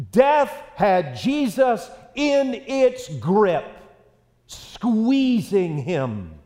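very slow, drawn out and weighty; slow emphasis. Acted. Preacher dwelling on death's grip, slow and heavy. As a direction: stretch every word like a squeeze. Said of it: "preacher slows for emphasis".

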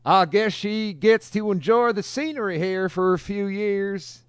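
high, slow put-on voice; mocking voice. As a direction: drawl it out in a mock smug voice. Quoting the article author.

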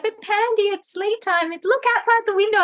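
put-on high little-girl voice protesting; imitating her daughter. Mother imitating five-year-old Kiki protesting to panda, high childlike voice. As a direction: mimic a small child pleading. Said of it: "And she's like".

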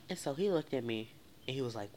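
plain reading voice

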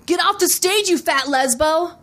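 mock yell from the audience, no real volume; heckler's call. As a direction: heckle in a put-on voice. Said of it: "says loudly".

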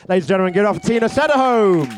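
loud, high host intro; big build-up to the applause; announcer voice. Real, not acted. MC announcing next act.

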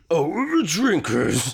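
put-on frail elderly man clearing his throat; very old man. Shown as a demonstration. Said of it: "What's your old man impression".

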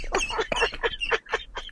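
high silent wheezing laugh; breathless laughter. Real, not acted. Wheezing with laughter at the cannoli story. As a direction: laugh so hard no sound comes out. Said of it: "This guy's lost it".

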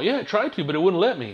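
high, re-enacted excuse; defensive protest. Customer explaining himself to the DMV guy, defensive. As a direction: pipe up in your own defence.

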